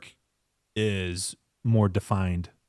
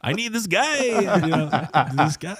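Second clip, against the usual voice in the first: high, Zoidberg-like client; pleading for the guy in a cartoonish Zoidberg voice; 10 semitones higher than usual. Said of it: "Sounds like Zoidberg".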